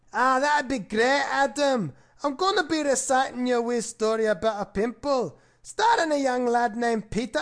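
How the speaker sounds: lightly put-on Scottish accent.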